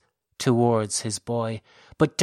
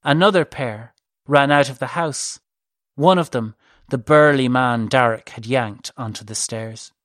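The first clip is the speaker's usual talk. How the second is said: ordinary delivery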